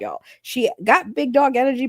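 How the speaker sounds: ordinary talk, nothing marked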